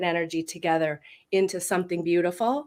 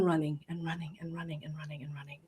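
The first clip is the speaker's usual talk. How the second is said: fast, soft whisper; breathless whisper; 4 semitones lower, 12 dB quieter, faster than usual. Retold or imitated. Recounting years of nonstop running, fast whispered rush. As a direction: rush it out under your breath. Describing her exhausting busy years.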